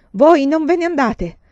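loud, high yell; shouting. A narrator's reading. Man orders the departing nun to stay, shouting. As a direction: shout to stop someone leaving. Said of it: "urlò Pu Xiao".

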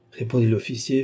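usual speaking voice

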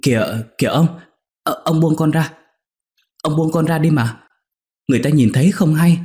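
high, urgent protest, speeding up; a maid urgently pleading with her master, high and hurried. Acted. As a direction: beg him to let go, flustered. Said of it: "Con Nhung lại nói gấp".

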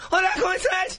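put-on very high, squeaky Mexican-accented voice; Tijuana accent. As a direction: shoot way up into a Tijuana caricature. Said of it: "get the ones from Tijuana".

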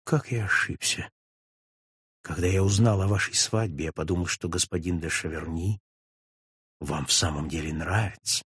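soft, low, falsely sorrowful; Darcy feigning regret to Julie, sighing hypocritically. A narrator's reading. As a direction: sigh it out with put-on regret. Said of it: "довольно лицемерно, вздыхая".

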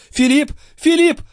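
very high, frantic calls; panicked high cry; calling for help. A narrator's reading. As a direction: summon him desperately, rising each time.

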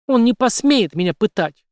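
high, loud challenge; defiant cry. A narrator's reading. Captive woman daring Henry to torture her, defiant cry. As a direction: throw it out as a dare. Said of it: "с вызовом выкрикнула она".

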